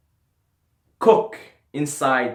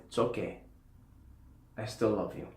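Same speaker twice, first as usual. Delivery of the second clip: softer, tender retold reassurance; genuine, deep place; 2 semitones lower, 9 dB quieter than usual. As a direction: say it gently as if forgiving them. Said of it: "from a genuine place".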